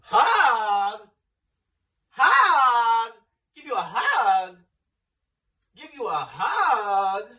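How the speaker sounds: loud, high disbelieving repeats; incredulous outrage. Real, not acted. Retelling Car asking for a hug, loud and incredulous. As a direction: stretch the last one out slowly in disbelief.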